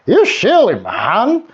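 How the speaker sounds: high, mocking trumpet of a voice; trumpeting elephant. A narrator's reading. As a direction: blare it out like a scornful elephant. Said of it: "he trumpeted".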